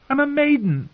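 put-on high, alarmed young woman's protest; girl protesting. A narrator's reading. Girl to the groping singer, high protest. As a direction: raise the pitch sharply in protest. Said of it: "she protested".

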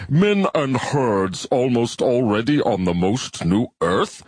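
slow, doubtful alien quoting back; slow skeptical bivalve. Acted. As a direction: repeat it slowly with disbelief. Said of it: "Your translation is not idiomatic".

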